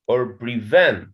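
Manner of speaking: "prevent" with the weight on VENT; "or prevent" with a stressed, falling VENT; second-syllable stress. Shown as a demonstration. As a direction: offer pre-VENT as the alternative. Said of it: "the stress in the second syllable".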